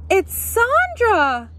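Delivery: very high, loud, drawn-out fan cry; excited scream. A narrator's reading. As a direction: scream the star's arrival, stretching it out. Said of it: "someone suddenly screamed".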